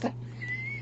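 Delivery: airy burst of laughter at herself; soft giggle bursting out; sudden laugh. Real, not acted. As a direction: break into a laugh at your own silliness. Laughs at own joke.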